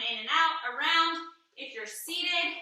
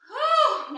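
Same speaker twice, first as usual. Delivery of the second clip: breathless exhale of relief; relieved whew; 9 semitones higher, 8 dB louder than usual. Real, not acted. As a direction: blow out a loud whew. End of a tough set.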